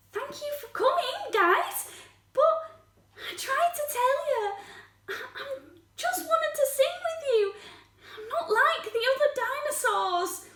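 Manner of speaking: high, small earnest voice; hurt dinosaur. Acted. Spike explains to visitors he only wanted to sing, high hurt voice. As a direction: explain yourself timidly, still wounded.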